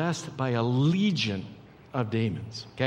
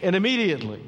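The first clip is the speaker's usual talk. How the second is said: high, impassioned storytelling; full-force preaching; 9 semitones higher, 5 dB louder than usual. Real, not acted. Preacher telling of the sick woman reaching for Jesus, full-force and high. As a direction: drive it high, stretching the key words toward the end.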